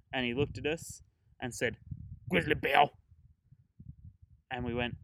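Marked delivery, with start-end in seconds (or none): 2.3-2.9 loudly barked out, as a retold stranger; imitating a gruff tourist, loud; blunt loud answer. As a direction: flatly announce the bear, loud.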